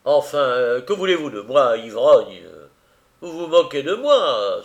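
put-on loud, angry shout at the drunk; furious outburst. A narrator's reading. Velchnaninov furiously shouts at the drunken husband, loud and angry. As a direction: snap at him, furious, stamping a foot. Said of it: "d'une voix furieuse".

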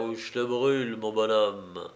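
ordinary delivery, nothing marked

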